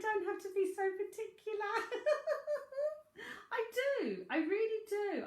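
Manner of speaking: high, giggling protest at being told off; Cockney medium giggling at a telling-off from spirit; laughing. Real, not acted.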